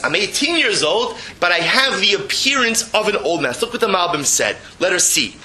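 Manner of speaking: ordinary talk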